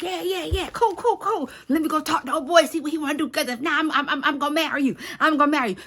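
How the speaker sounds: high, eager, tumbling repetitions; eager suitor. Retold or imitated. Boaz promising Ruth he'll talk to old boy, eager.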